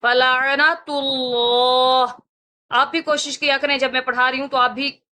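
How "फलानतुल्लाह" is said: फलानतुल्लाह very slow, full heavy Allah. Shown as a demonstration. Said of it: "इस तरह की हम पूरा आवाज करेंगे".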